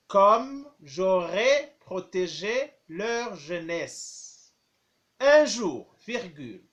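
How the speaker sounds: slow, clear dictation, phrase by phrase; dictating. Shown as a demonstration. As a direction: dictate each chunk slowly for pupils. Teacher dictating, repeating phrases.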